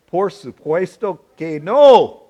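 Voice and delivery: slow, careful Spanish with an English accent; "Por supuesto que no" said slowly in clumsy Spanish; Spanish attempt. Shown as a demonstration. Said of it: "I just didn't accent it right".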